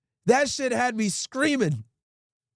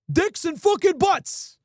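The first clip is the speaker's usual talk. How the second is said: mock insistent caller; 8 semitones higher, much slower than usual. Prank caller escalating the fake title.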